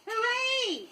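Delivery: high excited cheer; joyful cry. A narrator's reading. Peter Pan cheers victory over the pirates, high and joyful. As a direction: shout it up high in triumph. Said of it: "cried Pan".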